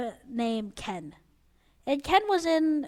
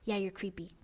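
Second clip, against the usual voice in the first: deadpan reply; 5 semitones lower, 10 dB quieter than usual. Retold or imitated. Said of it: "and then I was just like".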